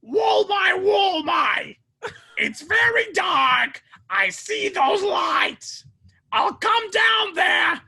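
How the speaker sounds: high, loud, crying shout; Gilbert Gottfried. Retold or imitated. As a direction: wail each line high and slow. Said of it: "That's Gilbert Gottfried".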